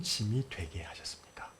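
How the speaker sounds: breathy whispered end of Job's plea; whispered lament to God. Acted. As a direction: let the question die into a whisper.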